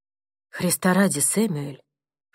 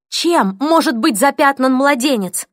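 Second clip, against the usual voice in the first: shocked protest; 6 semitones higher, 5 dB louder than usual. Acted.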